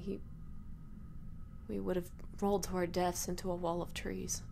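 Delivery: usual speaking voice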